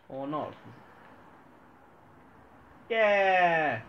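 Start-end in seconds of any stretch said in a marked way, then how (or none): 2.9-3.8 loud, high cheer; triumphant yell. Real, not acted. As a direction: cheer at the big hit. A thrown bomb goes off.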